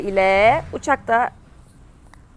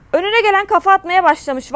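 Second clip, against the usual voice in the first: exasperated complaint; 7 semitones higher, 4 dB louder than usual. Real, not acted. Angry at enemies' headshots.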